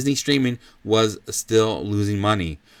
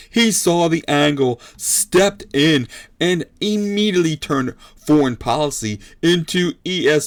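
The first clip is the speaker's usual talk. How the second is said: punchy, higher ringside call; fight commentator; 4 semitones higher, 3 dB louder than usual. Acted. Commentator praising Bob's opening, emphatic play-by-play. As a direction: call the exchange like a boxing broadcast.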